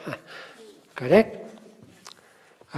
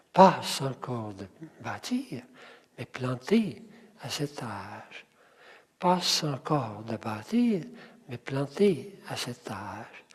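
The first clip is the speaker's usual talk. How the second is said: soft rhythmic line, leaning on "bâtir", "planté", "âge"; stress on last syllables; 4 semitones lower, 7 dB quieter than usual. Shown as a demonstration. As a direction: pause after each group, lengthening "-tir", "-té", "âge". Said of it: "mettre l'accent". (accent doux sur la dernière syllabe : « bâtir », « planté », « âge »)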